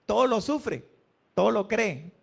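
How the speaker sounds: ordinary talk, nothing marked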